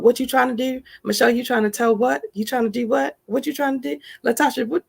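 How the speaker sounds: scoffing devil voice, pitch climbing to the last What; taunting devil voice. Acted. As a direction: needle her by name like a jeering little devil. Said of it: "it gets really, really loud".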